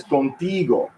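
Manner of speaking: "contigo" leaning long on TI; conTIgo, middle syllable stretched; next-to-last syllable stress. Shown as a demonstration. Teacher reading Spanish examples, "contigo" stressed on TI. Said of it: "on the next to the last syllable".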